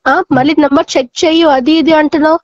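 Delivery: ordinary talk, nothing marked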